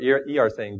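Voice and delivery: normal talking voice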